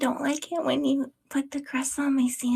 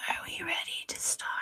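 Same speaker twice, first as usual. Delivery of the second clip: whispering; 8 dB quieter than usual. Real, not acted. Quiet check before recording starts.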